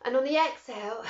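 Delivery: calm breathwork guide voice; guided breathwork. Acted. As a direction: talk them gently through each breath. Leads a breathing meditation.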